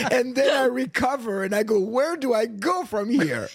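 high-pitched, laughing exclamation; amused, mock-helpless wail. Real, not acted. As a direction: draw the question out through real laughter. Laughing at himself between laughs.

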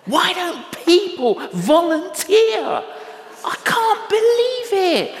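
high, loud mock outrage; indignant inner voice. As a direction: play your own self-righteous complaint in a high put-on voice. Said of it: "And I thought".

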